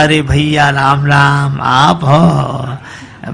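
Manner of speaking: low, slow, humble greeting to an elder; bow your voice to a respected old man; deferential old father-in-law. Acted. Father-in-law greets mirror image. (ससुर की धीमी, नीची, विनम्र आवाज़)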